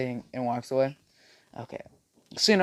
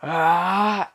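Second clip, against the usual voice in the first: drawn-out raised battle cry; loud, higher "aah" of powering up; power-up scream; 5 semitones higher, 5 dB louder than usual. Acted. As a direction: anime transformation yell. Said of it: "all of a sudden he screams".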